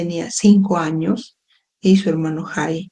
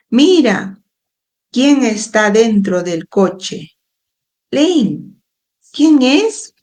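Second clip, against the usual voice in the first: high-pitched calls with a slower question between; coaxing distraction; 4 semitones higher, slower than usual. A narrator's reading. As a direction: call for a crying brother's attention, pitch jumping up on each call. Child coaxing crying brother with toy.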